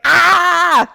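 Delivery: high loud yelp of pain; pained yell. Retold or imitated. Retelling the kitchen hit to partner, pained yelp. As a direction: cry out as if just hit. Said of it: "But I was like".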